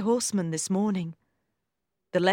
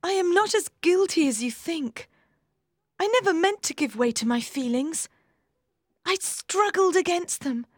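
put-on higher young woman's voice, earnest; Maggie, defending herself; 10 semitones higher than usual. A narrator's reading. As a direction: plead her case to her brother.

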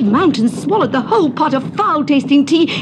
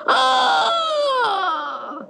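high, drawn-out cry of alarm; long wailing yell from under cover; acted roar of panic; guards' roar; 8 semitones higher, 3 dB quieter than usual. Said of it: "they roared".

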